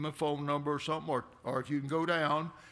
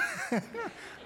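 high giggling burst; amused cackle; high laugh. Real, not acted. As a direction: laugh at the memory. Laughs at own story.

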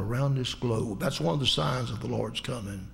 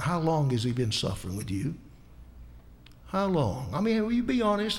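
usual speaking voice

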